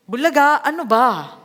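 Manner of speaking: loud, gruff jump-scare shout; rough mock startle. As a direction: bark it out, the opposite of gentle. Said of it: "gentle siya eh". (pasigaw at magaspang na panggugulat)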